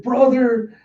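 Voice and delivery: high-pitched, holding back tears; mock tearful cry. Kiku greeting a returned brother, acted near-tearful high cry. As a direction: call out high, as if nearly crying. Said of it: "just trying not to cry".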